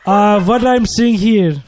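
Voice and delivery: put-on call-center agent in a lightly done African accent; mock African accent, slightly high. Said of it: "I couldn't do my African accent".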